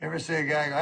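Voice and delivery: usual speaking voice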